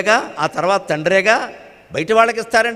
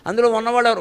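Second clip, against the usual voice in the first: normal talking voice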